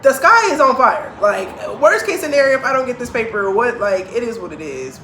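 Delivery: loud, high-pitched exasperated outburst; retold exasperation. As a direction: relive being fed up, raising pitch and volume. Retelling own exasperated reaction to pandemic.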